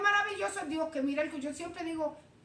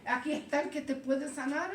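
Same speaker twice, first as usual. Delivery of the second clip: usual speaking voice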